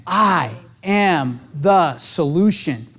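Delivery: slow, low and certain; affirmation. Shown as a demonstration. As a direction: land it slowly as a conviction. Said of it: "Totally changes my attitude".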